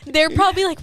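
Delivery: usual speaking voice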